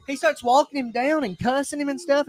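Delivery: ordinary talk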